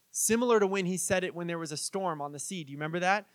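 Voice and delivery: ordinary talk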